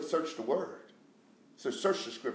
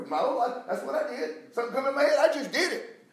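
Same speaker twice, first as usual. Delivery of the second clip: high, loud preaching; preacher's raised-voice testimony; impassioned testimony; 10 dB louder than usual. Real, not acted. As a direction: confess it loudly to the congregation.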